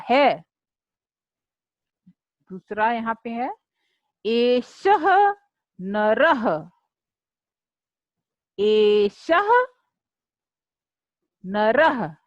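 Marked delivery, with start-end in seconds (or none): 4.2-12.1 एषः नरः said slowly and carefully, with a pause between the words; slow recitation for pupils to repeat; model pronunciation. Shown as a demonstration. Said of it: "हाँ, ऐसे साथ में बोलिए". (शिष्यों के लिए स्पष्ट उच्चारण)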